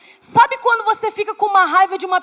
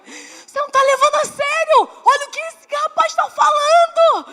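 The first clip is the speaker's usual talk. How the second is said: high, loud desperate outburst; shouting at husband who laughs at her anger; 8 semitones higher, 4 dB louder than usual. Retold or imitated. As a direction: yell it in exasperation. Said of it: "Eu desesperada".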